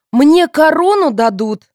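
high, loud, drawn-out insistence; indignant. A narrator's reading. Contestant claiming the crown, indignant. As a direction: insist shrilly on your due. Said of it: "возмутилась Марина".

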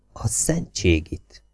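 soft, slow, stunned oath under the breath; quiet curse. A narrator's reading. Aide quietly cursing on reading the leaked message. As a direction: let it out barely voiced in shock. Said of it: "Jakes halkan megszólalt".

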